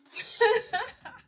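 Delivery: big burst of laughter; loud laugh at the gossip. Real, not acted. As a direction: cackle out loud.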